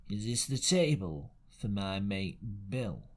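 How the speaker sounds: low, slow, leering seaman's question; a lightly leering old sailor, low and slow. Acted. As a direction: lean in with a leer. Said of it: "with a kind of leer".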